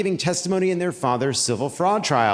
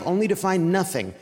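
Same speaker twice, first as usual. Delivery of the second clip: normal talking voice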